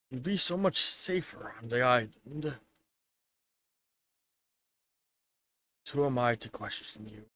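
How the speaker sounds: low, quiet musing to himself; soft, private worrying aloud; Jack, brooding alone. Acted. Jack alone, worrying about Janice's safety, quiet and low. Game master voices Jack.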